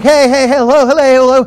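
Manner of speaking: high, loud, repeated hailing; urgently flag down a driver; acted-out eager hellos; calling out. Hailing another passing driver.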